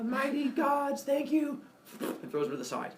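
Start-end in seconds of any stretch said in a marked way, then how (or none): 0.0-1.6 put-on high solemn baby voice; tiny child playing priest; baby Hermes. Baby thanks gods.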